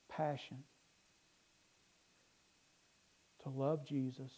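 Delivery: soft, unhurried pastoral prayer; quiet, earnest closing prayer; heartfelt prayer. Real, not acted. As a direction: pray gently, with long pauses between petitions.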